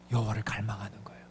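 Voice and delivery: quick, soft whisper of longing. Real, not acted. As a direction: breathe it out like a secret. Same phrase whispered, quick. (빠르고 낮은 속삭임)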